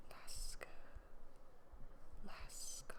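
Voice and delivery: whispered to herself; whispering. Real, not acted. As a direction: murmur the strange word. Reader mumbles an unknown word.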